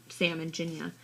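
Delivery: ordinary delivery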